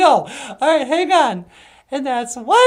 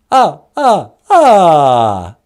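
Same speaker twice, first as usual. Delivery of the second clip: counted-out villain laugh, "ah, ah, ah"; low, booming staccato cackle, last one drawn out; Count Von Count; 14 semitones lower, 6 dB louder, much slower than usual. Acted. Caller announcing himself to the operator, theatrical vampire laugh. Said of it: "Well, hi, Count".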